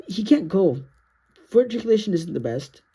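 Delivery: ordinary talk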